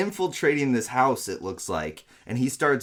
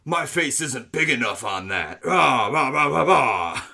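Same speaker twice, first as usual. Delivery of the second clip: macho bro voice with grunts; over-the-top gym bro, yelling rah rah. Retold or imitated. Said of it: "he's doing bro voice".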